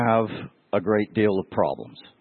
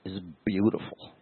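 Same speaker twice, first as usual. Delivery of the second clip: softer, choked up, fighting back tears; tearful; 4 dB quieter than usual. Real, not acted. Pastor moved to tears telling the congregation of Jesus' gentleness, tearful and softer. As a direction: let the emotion catch in the throat. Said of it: "why am I crying?".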